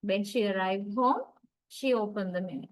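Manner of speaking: "when she arrived home" rising, "she opened the mail" falling; rise then fall. Shown as a demonstration. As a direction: lift the clause, then settle. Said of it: "the first tone group gets the rising intonation".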